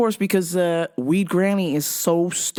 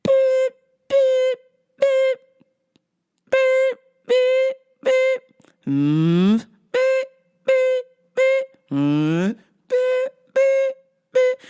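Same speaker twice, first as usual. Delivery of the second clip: wheelchair beep; much slower than usual. Retold or imitated. Imitating wheelchair backing beeps.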